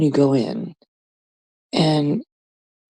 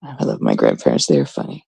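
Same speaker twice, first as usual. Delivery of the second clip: much lower, softer deadpan voice; mock deep voice; 5 dB quieter than usual. Comic says she loves her grandparents, deep deadpan aside. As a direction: drop into a deep ironic aside.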